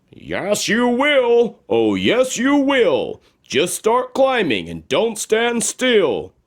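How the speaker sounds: magic shoes encouraging. Acted. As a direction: cheer her up the hill. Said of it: "came a voice, or rather two voices".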